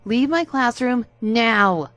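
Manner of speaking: slow, high, loud teacher's order; furious order. Acted. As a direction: throw him out of the room, slowly and loudly. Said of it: "A T got red in the face".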